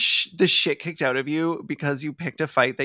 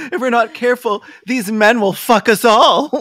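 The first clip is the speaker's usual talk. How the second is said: melodramatic; 5 semitones higher, 6 dB louder than usual. Pretend. Mock melodramatic fearful warning.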